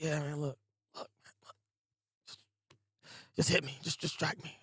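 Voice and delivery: hushed, empty begging; hollow plea. Retold or imitated. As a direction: ask to be struck, drained and soft. Thorfinn begs to be hit.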